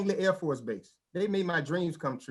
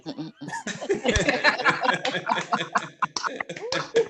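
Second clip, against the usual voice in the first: laughing; 6 semitones higher than usual. Real, not acted. Laughs at own gag.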